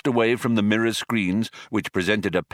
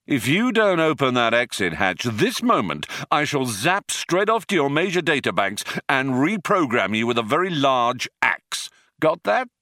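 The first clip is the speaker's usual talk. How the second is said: forceful, rising ultimatum; threatening; 6 semitones higher than usual. Acted. Zaphod threatens to reprogram the computer, forceful anger. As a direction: lay down a threat, ending high.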